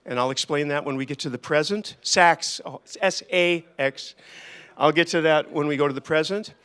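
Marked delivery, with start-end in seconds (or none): 3.0-4.1 slow, letter by letter: S, A, X; spelled out. Shown as a demonstration. Spelling his nickname for the meetup crowd, slow letter by letter. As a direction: spell each letter out slowly and clearly.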